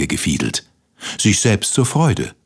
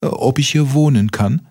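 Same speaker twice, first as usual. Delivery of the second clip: lightly voiced, higher polite question; pleading cricket; 6 semitones higher than usual. A narrator's reading. Cricket asks the mole for shelter, pleading. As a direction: ask hopefully with lifted pitch.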